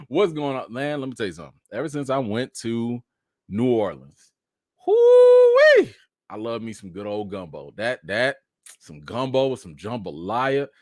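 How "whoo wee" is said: long, high, loud "whoo-wee"; excited whoop. Real, not acted. Streamer raving about New Orleans food, excited whoop. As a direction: let out a big drawn-out holler.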